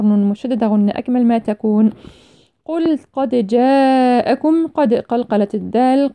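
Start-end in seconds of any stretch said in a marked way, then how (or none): 2.6-4.7 slow recitation, long-held aa in جاءكم; Quranic elongation. Shown as a demonstration. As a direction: chant it, stretching the alif of جاءكم and bouncing the dal of قد. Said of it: "مد متصل أربع أو خمس حركات". (ترتيل بطيء مع مد الألف في جاءكم)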